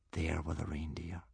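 soft, whispered suspense; hushed narration. A narrator's reading. Narrator as Toothless peeks at the herd, whispering. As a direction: breathe it out in a whisper.

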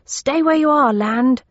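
calling loudly up the stairs; yelling upstairs. Acted. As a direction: shout it up to the floor above. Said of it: "I turned and yelled upstairs".

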